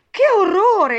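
high, loud cry of horror; horrified exclamation. A narrator's reading. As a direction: cry out in alarm. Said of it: "esclamò Alice". (esclamazione acuta e inorridita)

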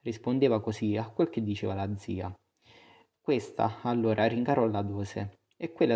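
usual speaking voice

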